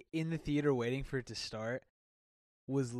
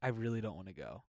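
low, softer retold refusal; serious; 5 semitones lower, 7 dB quieter than usual. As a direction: imitate a wife turning quietly serious. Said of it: "she got serious".

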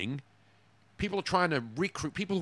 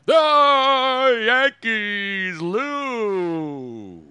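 long drawn-out booming announcer call; 9 semitones higher, 9 dB louder, much slower than usual. Pretend. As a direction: stretch it out like a ballpark radio call. Mocks Yankees radio home-run call.

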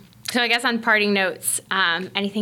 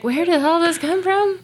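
higher-pitched retold bewilderment; bewildered; 9 semitones higher, 3 dB louder than usual. Host recalling first therapy session, bewildered. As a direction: question your own tears, voice lifted.

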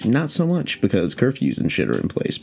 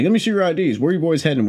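pitched-up imitation of a swaggering officer; lightly put-on macho police questioning; mock macho cop; 7 semitones higher, faster than usual. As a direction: demand it like a cop acting tough. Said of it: "comes up to us all macho".

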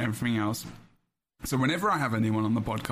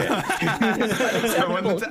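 laughing; 6 semitones higher, 5 dB louder, much slower than usual. Real, not acted. Laughing repeat of own point.

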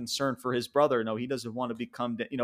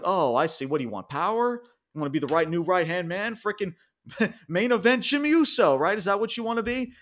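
higher, sneering taunt at Jimmy; mocking Roman; 6 semitones higher than usual. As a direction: scoff at his ambition like a smug boss. Roman mocks Jimmy in recap.

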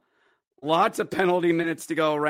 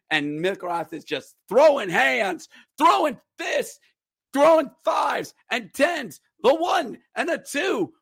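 much higher, shouting play-by-play; excited fight call; 8 semitones higher than usual. Real, not acted. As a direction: call the fight like it's the best moment of the night.

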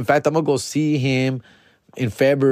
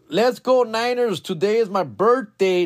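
higher, hyped-up read of a fan's birthday note; host reads a fan's birthday shout-out, excited and higher; message read aloud; 9 semitones higher than usual. Acted. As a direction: read it with a cheer.

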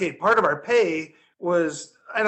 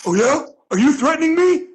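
higher, louder confrontational snap; mock drunk challenge; 8 semitones higher, 6 dB louder than usual. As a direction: square up to the bum. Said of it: "And Tut goes".

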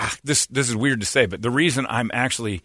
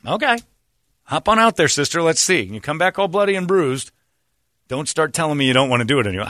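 taunting; 6 semitones higher, 3 dB louder than usual. Retold or imitated. Taunting coach reply.

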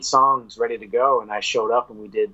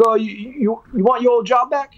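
teasing manager; 10 semitones higher than usual. Retold or imitated. Manager offers job back.

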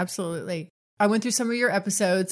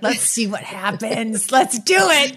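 playful egging on; 4 dB louder, slower than usual. Retold or imitated. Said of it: "I'm like".

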